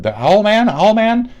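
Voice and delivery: high, loud character-name call; excited cry. Pretend. Streamer calling out a Watchmen character, high and excited. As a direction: belt it out high, drawing attention.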